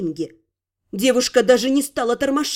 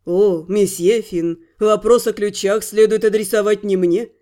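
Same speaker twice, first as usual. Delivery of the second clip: mysterious mage; 5 semitones lower than usual. Acted. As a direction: let a secret smile sit in the words. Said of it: "с таинственной полуулыбкой".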